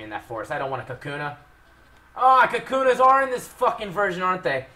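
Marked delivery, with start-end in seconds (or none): 2.2-4.7 loud, high exasperated groaning protest; dismayed complaint. Real, not acted. Player dreading Kakunas in this version, dismayed complaint.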